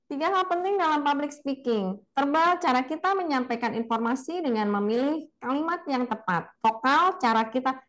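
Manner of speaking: high-pitched recited student delivery; student's weak voice. Retold or imitated. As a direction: imitate a nervous student just reading off the slide. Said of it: "jadi dia menyampaikannya seperti itu".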